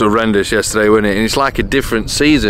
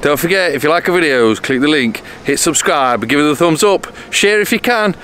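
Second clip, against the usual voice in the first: upbeat outro; 5 semitones higher than usual. Acted. Vlog outro sign-off.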